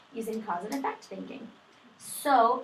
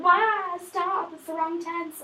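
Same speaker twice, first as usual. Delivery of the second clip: put-on high, loud exasperated kid; mock childish protest, pitched way up; protesting second grader; 8 semitones higher, 8 dB louder, much slower than usual. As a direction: cry out like an upset young pupil, drawing it out. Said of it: "The second grader would be like".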